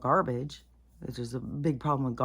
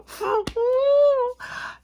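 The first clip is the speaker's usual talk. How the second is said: loud high laughing cry; exasperated high laugh; 8 dB louder than usual. Real, not acted. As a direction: burst into a despairing laugh.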